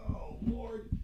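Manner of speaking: breathy whisper under the breath; whispered oh Lord. Real, not acted. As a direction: mutter it to yourself in a whisper, exasperated. Fiddling with broken phone, muttering.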